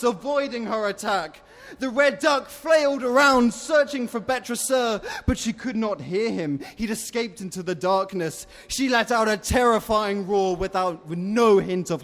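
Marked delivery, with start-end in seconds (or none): none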